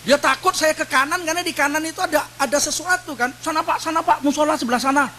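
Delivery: hurried security man steering him away. Retold or imitated. Guard with walkie-talkie urgently pointing the cleric to the prayer room. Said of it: "Dia bilang".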